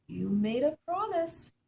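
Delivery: the king scolds his daughter; stern king. A narrator's reading. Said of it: "said the king".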